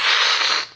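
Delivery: voiceless rush of breath as a monster noise; breathy mock-monster hiss; imitated creature hiss. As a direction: mimic a creature hissing. Imitates creature facing its victim.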